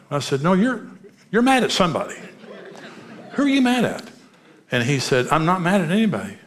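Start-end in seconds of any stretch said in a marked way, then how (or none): 0.4-2.2 high, exasperated contradiction; retold pushback, pitched well up; insistent. Pastor contradicting a counselee's denial, insistent. 3.3-4.1 very high, insistent demand; retold pestering, pitch soaring then falling; exasperated. Pastor pressing a counselee who denies anger, exasperated. 5.3-6.4 higher, more emphatic retold protest; protesting denial. Counselee protesting to the pastor, defensive.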